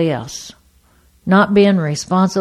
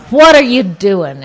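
louder, drawn-out yell at him; retold yell; 6 dB louder than usual. Wife across the table to her drinking husband, yelling. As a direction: holler it across the table, stretching the words. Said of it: "And I yell at him".

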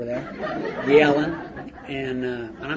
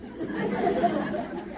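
breathy high real laugh. Real, not acted. Recalling the naked prisoner in the strip cell, real laughter. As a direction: let the laugh burst out.

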